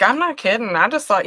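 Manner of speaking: usual speaking voice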